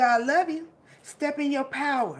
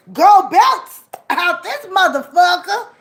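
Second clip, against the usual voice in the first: louder and slower, each word punched; slow loud hype; 5 semitones higher, 13 dB louder than usual. Real, not acted. Rallying viewers, slow loud triumphant shout. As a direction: drag out the victory cry. Hyping up the crowd.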